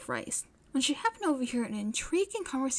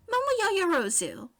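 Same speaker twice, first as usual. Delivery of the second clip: high and loud; eager; 9 semitones higher, 4 dB louder than usual. A narrator's reading. May naming Yaoyorozu to her face, high and eager. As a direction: announce her name proudly.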